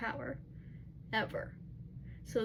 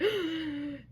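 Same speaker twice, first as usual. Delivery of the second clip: shocked gasp; 6 semitones higher than usual. Retold or imitated. Ethan senses alpha energy.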